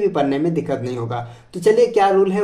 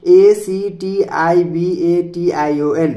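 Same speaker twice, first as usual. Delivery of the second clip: slow, letter by letter, each letter apart; spelling out; much slower than usual. Shown as a demonstration. Teacher spells education for students, slow letter by letter. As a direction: spell it out slowly for the class. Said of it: "spelling the word out".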